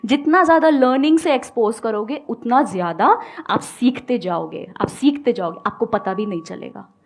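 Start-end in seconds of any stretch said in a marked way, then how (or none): none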